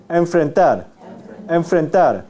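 teacher drilling Enfrentar loud and clear; model pronunciation. Shown as a demonstration. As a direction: say Enfrentar as enmfrentar, lip touching teeth. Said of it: "es M nasal labio dental".

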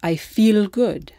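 I feel good, slow, with feel ending in a light L; Italian accent. Shown as a demonstration.

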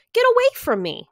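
loud, high furious shout; angry high shout. A narrator's reading. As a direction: shove him away with your voice. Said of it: "cried the Rainbow Fish".

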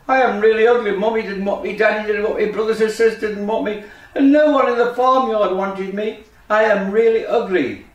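high, insistent little duckling; lamenting duckling. Acted. Duckling tells frog nobody wanted him, upset and insistent.

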